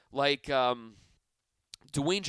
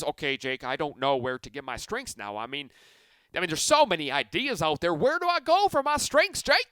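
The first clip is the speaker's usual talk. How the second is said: a poor attempt at a Texas twang; southern twang; 5 dB louder than usual. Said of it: "That's my southern twang voice".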